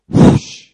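loud breathy whoosh; explosive voiceless rushing noise; whoosh of fire. Retold or imitated. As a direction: imitate a burst of flame, all air. Imitating fire falling from heaven.

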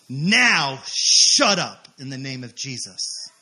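loud, slow, punched-out command; commanding rebuke. Retold or imitated. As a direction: hit each word hard and stretch it. Speaker acts out the rebuke.

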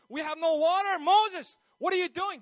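loud, high outraged cry; complaining. Acted. Thirsty Israelites ready to stone Moses, loud angry complaint. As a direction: turn on the leader, acted angry protest. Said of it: "they're complaining".

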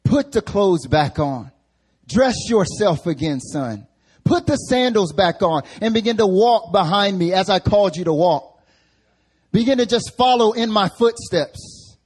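loud preacher voicing God's orders; commanding call. Acting out a divine command.

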